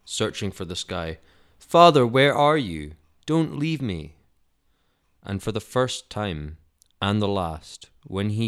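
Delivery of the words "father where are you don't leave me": louder, higher desperate call to an absent father; frantic crying out. Acted. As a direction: cry out in panic, begging not to be left. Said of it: "Jesus cried frantically".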